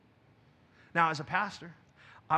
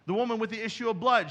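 ordinary talk, nothing marked

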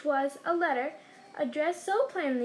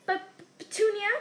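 higher, startled gasp of a name; 7 semitones higher, 4 dB louder than usual. A narrator's reading. Uncle shocked by the letter, gasped. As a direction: gasp it to his wife. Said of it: "he gasped".

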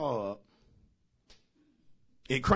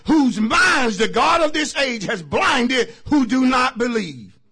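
verse cried out loud and high, slowly; shouted scripture; 5 semitones higher, 12 dB louder, slower than usual. Shown as a demonstration. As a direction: thunder the verse to close. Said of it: "I'm closing with this".

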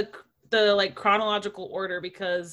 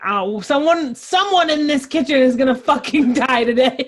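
raised voice, half-laughing outburst; loud mock threat; 4 semitones higher, 7 dB louder than usual. Retelling the kitchen fight to a co-host, loud mock threat. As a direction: act out a loud, over-the-top threat. Acting out movie character's threat.

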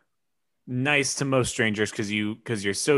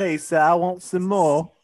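lightly mimicked British orphan begging; orphan child; 5 semitones higher than usual. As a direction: play a pleading little British orphan. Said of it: "orphan child that's giving the news while asking for gruel".

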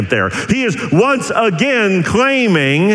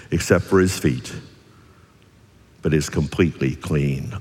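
soft, low and tender; compassionate; 12 semitones lower, 4 dB quieter than usual. Retold or imitated. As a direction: gently reassure Simon. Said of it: "the compassion of Jesus when he says".

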